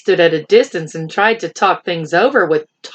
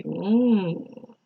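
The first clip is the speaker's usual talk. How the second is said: plain reading voice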